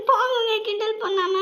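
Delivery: bashful giggle into the words; giggling protest. Acted. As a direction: laugh through a playful protest. Wife reacts to husband's teasing.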